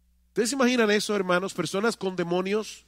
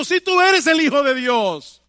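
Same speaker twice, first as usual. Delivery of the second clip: loud, high, slow mock proclamation; demons flattering; 7 semitones higher, 7 dB louder than usual. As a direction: play the sly demon praising Jesus. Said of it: "Los demonios están diciendo".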